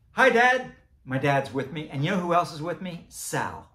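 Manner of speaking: usual speaking voice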